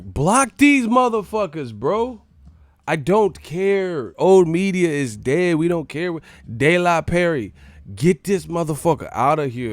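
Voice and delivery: high, forceful dismissive shouting; loud rant. Real, not acted. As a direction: yell to have them thrown out. Ordering chat critics blocked.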